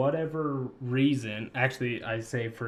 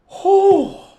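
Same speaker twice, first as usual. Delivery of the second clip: startled; 17 dB louder than usual. Real, not acted. Jolted by jump scare again.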